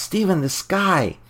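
high, drawn-out mock cry of alarm; stretched, high-pitched pretend shout. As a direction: imitate calling out a warning, without real volume. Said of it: "Clea shouts".

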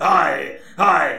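slow, drawn-out groans of pain. Acted. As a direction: groan each hai as if struck. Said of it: "एक बार हाय की आवाज निकल आती थी".

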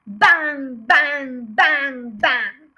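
bang it out loud, each shot fading a little; loud gunshot bangs. A narrator's reading. Narrator bursting out with the gunfire, loud bangs.

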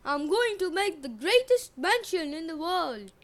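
loud swaggering prince voice; boastful brute. Acted. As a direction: boast like the coolest guy in town. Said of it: "Eric bellowed".

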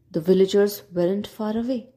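ordinary delivery

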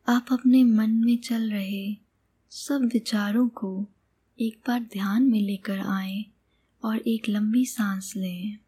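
calm meditation guide, long pauses between phrases; guided meditation. Acted. Meditation guide leads listener on an imagined sky journey, calm and unhurried. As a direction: guide the listener gently, leaving space after each phrase.

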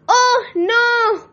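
a worried whimper of dismay. A narrator's reading. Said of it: "thought Little Dragon".

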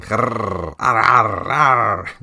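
playful snarling growl, rising in pitch and volume; hammed-up little-head growl while chewing; mock growling. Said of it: "he's snarling and growling".

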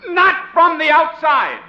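slow, high, loud command; exasperated order. Acted. Boss ordering his employee into the office, exasperated. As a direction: spell it out loudly to a fool.

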